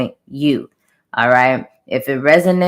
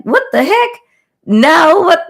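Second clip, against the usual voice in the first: much higher, louder shocked cry; shocked disbelief; 13 semitones higher, 5 dB louder than usual. Retold or imitated. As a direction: blurt it out in sudden disbelief. Shocked reaction in the dream.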